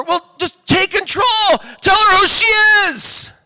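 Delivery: high, loud, forceful command; exasperated shouted advice. Acted. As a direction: drive the advice home by yelling.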